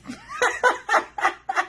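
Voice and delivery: high bursting laugh at her own joke; cracking up at the book; real laugh. Real, not acted.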